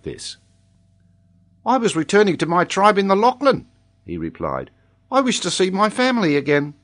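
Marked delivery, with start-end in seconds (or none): 1.6-3.7 put-on high, loud child with accent; Tolini. A narrator's reading. Child answers in character voice. 5.1-6.7 put-on high, earnest child with accent; Tolini. A narrator's reading. Child answers in character voice.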